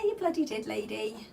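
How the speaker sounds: ordinary delivery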